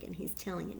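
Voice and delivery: usual speaking voice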